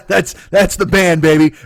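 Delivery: loud, high, laughing boast; laughing delight. Real, not acted. Host tickled by the silly band name, laughing. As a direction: crow it slowly through laughter.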